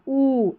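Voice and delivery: ऊ held long; long, sustained "oo" on ऊ; long vowel. Shown as a demonstration. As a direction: stretch ऊ out. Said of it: "ये लंबा उच्चारण देगा".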